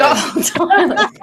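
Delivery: laughing. Real, not acted. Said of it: "gold toilet all the way".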